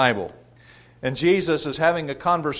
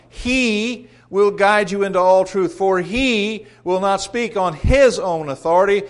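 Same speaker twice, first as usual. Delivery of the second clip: higher-pitched Bible verse, leaning hard on each "He"; emphatic scripture reading; 5 semitones higher, 4 dB louder than usual. Acted. Preacher showing the congregation the masculine pronoun, "He" stressed emphatically. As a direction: read it out forcefully, stretching and punching "He". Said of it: "Emphatic in the Greek".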